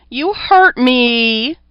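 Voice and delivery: slow, high, loud falsetto accusation; chorus of falsetto voices. A narrator's reading. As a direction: drag it out like tiny voices mimicking a woman. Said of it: "falsetto which sounded almost like Sarah".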